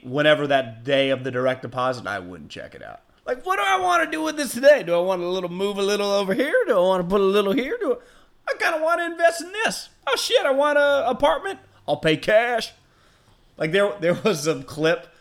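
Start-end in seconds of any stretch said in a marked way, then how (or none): 3.3-12.7 much higher, quick, laughing wonder; giddy daydreaming rich guy. Pretend. Host imagines payday as a star, giddy and high. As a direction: play a kid in a candy store with money. Imagines spending his own deposit.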